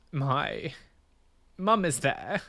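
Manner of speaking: halting, close to tears; real distress. Real, not acted. Recalling mum with her arm up, halting and upset. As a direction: struggle to get it out, with long pauses. Struggling to tell a traumatic memory.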